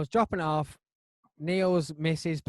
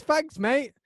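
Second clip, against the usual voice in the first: quick, higher, louder mock thanks; imitated offhand thanks; 10 semitones higher, 7 dB louder than usual. Customer taking the bag at the door, offhand imitation. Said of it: "he says, he says".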